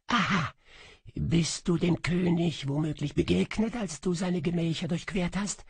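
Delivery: high, ghostly, sighing echo voice; a lost spirit murmuring its lament from a kettle; high wistful whispery phantom; faint melancholy echo. A narrator's reading.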